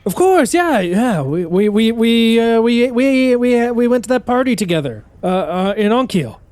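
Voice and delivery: high, stammering nervous dwarf; flustered stowaway. Acted. Stowaway faking a shared past with Faunnelin, high flustered stammer. Stowaway keeps up his bluff.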